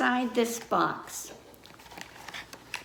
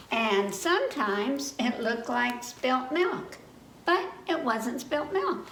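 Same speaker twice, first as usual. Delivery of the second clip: put-on reading to children. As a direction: read it like story time for little kids. Reading a picture book aloud.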